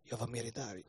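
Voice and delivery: softly, a tender repeated reassurance; quiet, tender I'm-with-you voice; gentle voice of God. Retold or imitated. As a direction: hush it down like a comforting presence. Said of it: "Han sa".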